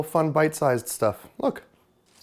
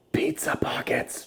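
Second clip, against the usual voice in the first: breathy whispered echo; 7 dB quieter, slower than usual. Pretend. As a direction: whisper it like a secret.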